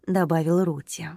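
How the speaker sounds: ordinary delivery, nothing marked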